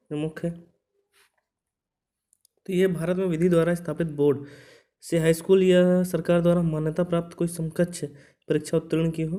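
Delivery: ordinary talk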